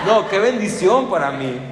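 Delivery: high, loud, delighted; thrilled exclamation of gratitude; excited. Real, not acted. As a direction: burst out with joy. Excited about blessing.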